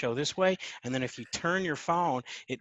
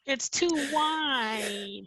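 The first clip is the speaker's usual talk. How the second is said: put-on high, slow, whiny teen voice; mock a kid who won't turn the phone; whiny young person impression; 13 semitones higher, much slower than usual. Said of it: "What a perfect impersonation".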